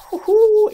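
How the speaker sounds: high, loud "woohoo"; excited whoop. Real, not acted. Gamer kicking off the final mission, excited whoop.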